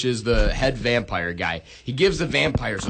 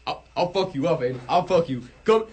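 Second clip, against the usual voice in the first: mock threat; 5 semitones higher than usual. Mock threat at friend Aiden.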